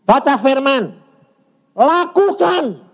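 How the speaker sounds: high, ringing command; forceful proclamation. Real, not acted. Preacher urges congregation to act on scripture, forceful cry. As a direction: proclaim it high, stretching the last word.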